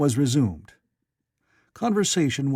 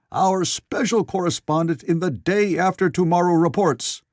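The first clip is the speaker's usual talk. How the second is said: mock shouting; 6 semitones higher, 3 dB louder than usual. A narrator's reading. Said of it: "or rather shouting".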